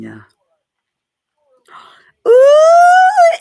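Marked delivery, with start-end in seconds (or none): 2.3-3.4 high delighted shriek of recognition; excited squeal. Real, not acted. [name] spotting Miss Alana in chat, shrieking. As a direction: squeal with joy at a name.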